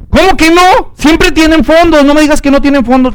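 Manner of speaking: customer's stunned outcry, pitched high; outraged disbelief. Acted. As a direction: shoot up in shocked outrage at the clerk. Customer protests bounced cheque.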